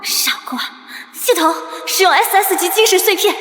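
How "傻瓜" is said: whispered scolding. Acted. Muttered to herself after rescue.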